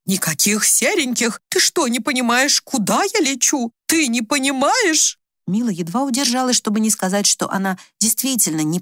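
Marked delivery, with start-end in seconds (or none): 0.1-5.2 high, drawn-out exasperation; indignant refusal. A narrator's reading. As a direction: stretch the words with raised indignation. Mother angrily rejects the suggestion.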